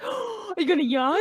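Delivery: high breathless mock question; mock gasp. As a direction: gasp it out theatrically.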